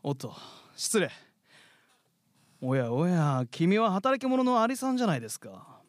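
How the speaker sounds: lightly voiced carefree grasshopper, showy; a lazy singer playing polite. Grasshopper character, idle and showy. (気取ったキリギリスの声)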